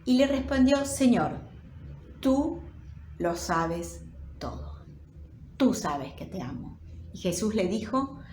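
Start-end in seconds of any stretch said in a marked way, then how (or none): 0.8-6.8 low, soft, slowing avowal of love; saddened. Acted. Peter answering Jesus's third question, saddened. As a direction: answer with quiet hurt, pausing between phrases. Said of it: "se entristeció de que le dijese".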